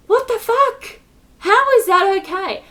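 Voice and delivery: high-pitched, incredulous protest; indignant outburst. Real, not acted. Challenging those who call this okay, indignant. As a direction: burst out in real indignation. Angry outburst at own situation.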